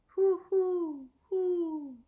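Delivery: high hooting owl call; loud, high, echoing owl hoots. Acted. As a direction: hoot it out like a great horned owl. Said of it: "The sound of a great horned owl".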